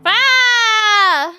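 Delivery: high, loud schoolgirl voice full of energy; excitable high girl. Acted. Schoolgirl picking her silly attack, high and eager. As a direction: pitch it way up like a hyper schoolgirl. Girl hurls mailbox at tank.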